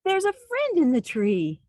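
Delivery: high, loud happy announcement; delighted reveal. Acted. Narrator revealing the friend to children, delighted. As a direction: announce the rescue with glee. Story turn, friend arrives.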